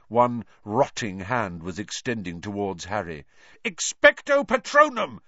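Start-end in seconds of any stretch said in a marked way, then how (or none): 3.6-5.2 high, loud desperate incantation; yelled spell. A narrator's reading. Boy casting at the Dementor, yelled spell. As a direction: yell the spell at full force. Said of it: "Harry yelled".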